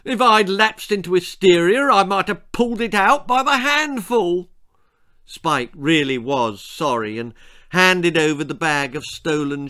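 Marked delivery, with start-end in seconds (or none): 0.0-4.5 high, loud tearful tirade; overwrought, high-pitched crying complaint; blubbing. A narrator's reading. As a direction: blubber it in an indignant wail. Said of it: "she blubbed".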